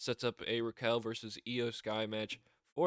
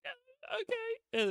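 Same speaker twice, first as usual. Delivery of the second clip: meek reply; much slower than usual. Retold or imitated. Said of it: "she's like".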